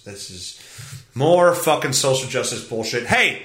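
ordinary talk, nothing marked

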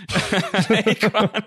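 cracking up at the silly name; laughing. Real, not acted. Host laughing while repeating the rapper's name. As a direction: say the name through a real laugh.

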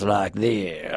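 put-on slow, stretched Southern accent; mock Southern drawl. Host doubts anyone talks so, exaggerated Southern drawl. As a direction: lean into a thick Southern drawl. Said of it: "that specific American Southern dialect".

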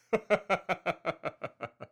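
high, rippling cackle that falls away; self-amused laugh that keeps going; long laugh. Acted. Admitting he can be a bastard, long laugh.

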